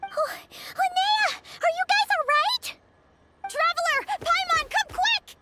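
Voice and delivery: high, eager call across the field; excited calling out. Acted. Linnea calling Traveler and Paimon over, excited high call. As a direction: call the friends over, thrilled.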